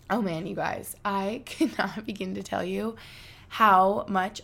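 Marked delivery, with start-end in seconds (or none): none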